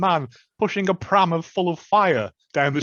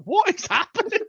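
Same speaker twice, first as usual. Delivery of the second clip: incredulous. Retold or imitated. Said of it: "I was like".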